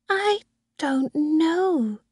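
put-on high, very slow little girl; Susan. A narrator's reading. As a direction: answer like an unsure small girl. Little girl answers nanny.

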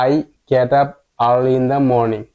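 very slow, word by word; slow model reading. Shown as a demonstration. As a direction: read it slowly for learners. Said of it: "পুরো লাইনটি আমরা পড়ি". (ধীরে ধীরে পড়া)

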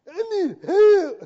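high, loud, stretched-out mock wail; mock desperate plea. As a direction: imitate someone bawling for help, hammed up. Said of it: "How'd you like people to talk to you like that?".

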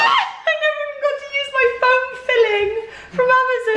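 high, laughing mock lament; hammed-up grievance, pitched high; mock wail. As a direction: wail in playful protest. Mock complaint about abandoned craft project.

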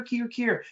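high keer, jay copying a hawk; hawk call imitation. Author mimics jays copying hawks, high cry. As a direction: squeal it like a blue jay.

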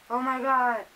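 muffled behind leather mask; speaking with covered mouth; through a face mask. Real, not acted.

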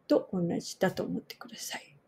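ordinary delivery

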